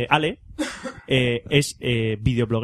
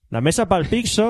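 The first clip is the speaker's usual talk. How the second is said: mock name; 7 semitones higher, slower than usual. Joking mangled brand name.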